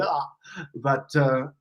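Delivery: ordinary delivery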